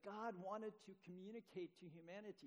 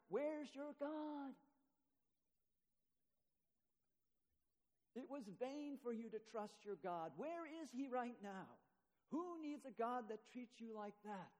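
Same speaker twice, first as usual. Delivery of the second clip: Satan; 4 semitones higher than usual. Acted. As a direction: jeer at a broken believer, stretching the words. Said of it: "Satan sits on my chest reciting his dirge".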